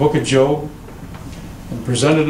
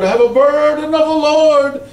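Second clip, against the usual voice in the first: high, louder mock-prophetic wail; wailing old prophetess; 12 semitones higher, 4 dB louder than usual. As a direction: ham up a dramatic church lady. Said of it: "she says".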